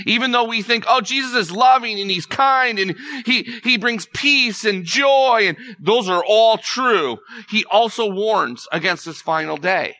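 full-voiced fervent preaching on Jesus' kindness; impassioned preaching. Real, not acted. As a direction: let it ring out from the pulpit. Impassioned climax about Jesus' love.

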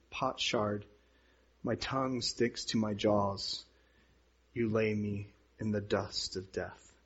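low, hushed psalm reading; quiet, sunken voice of suffering; weakened scripture lament. A narrator's reading. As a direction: read it softly, as if drained. Reads the suffering verses softly.

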